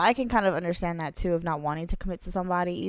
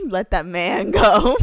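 louder, cracking up mid-line; laughing through it; 9 semitones higher, 8 dB louder, slower than usual. Real, not acted. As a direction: crack up while repeating the pun.

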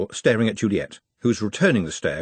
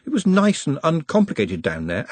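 usual speaking voice

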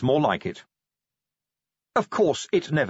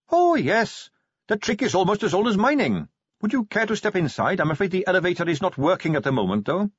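lightly voiced eager Carrot; 9 semitones higher than usual. Carrot shows the mine.

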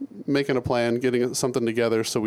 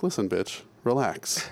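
low, much softer brush-off; mock dismissive; 4 semitones lower, 5 dB quieter, slower than usual. Host retelling how he brushed off the Apple lady. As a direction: wave her off coolly. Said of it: "I was like".

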